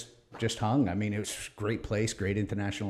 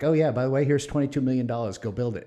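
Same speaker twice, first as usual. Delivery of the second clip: higher, louder offhand boss; breezy boss handoff; 4 semitones higher, 7 dB louder than usual. Retold or imitated. Bosses casually handing over millions, offhand. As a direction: toss him the money like it's nothing. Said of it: "they were like".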